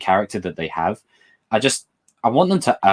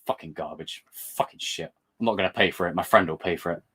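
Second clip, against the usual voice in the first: low, much softer disgusted grumble; mock grumbling hater; 6 semitones lower, 5 dB quieter than usual. Third type of trailer viewer, muttering contempt. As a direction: grumble like a cynic who hates everything. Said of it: "the other one where it's like".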